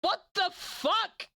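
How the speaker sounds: high, slow baffled gasps; real shocked surprise; stunned disbelief. Real, not acted. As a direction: react as if you can't believe it. Surprised by fifty gifted subs.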